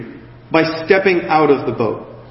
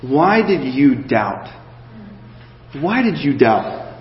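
Jesus asking; 4 semitones lower, 3 dB quieter, slower than usual. Retold or imitated. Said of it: "he goes".

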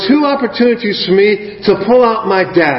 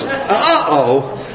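uh-oh; 5 semitones higher than usual. Retold or imitated. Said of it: "is exactly my response".

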